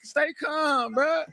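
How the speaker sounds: high laughing shout telling them to calm down; mocking taunt. Real, not acted. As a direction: razz the rival corner, half laughing. Taunting the noisy rival camp.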